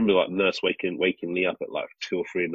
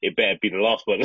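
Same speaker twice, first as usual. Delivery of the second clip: chuckling through a wry thought; laughing; 4 dB louder than usual. Retold or imitated. Recalling his thought on the ward, said through laughter. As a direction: laugh while you say it.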